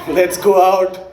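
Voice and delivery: low, slow put-on smooth talker; lightly voiced sleazy charmer, laughing; a hint of a slow creep line; mock smooth Indian guy. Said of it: "He's not like".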